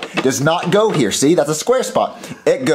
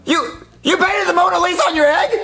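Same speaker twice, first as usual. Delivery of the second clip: much higher, astonished outburst; amazed high yelling; 13 semitones higher than usual. Acted. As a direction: shriek it like you can't believe your eyes. Mario amazed at Jeffy's painting.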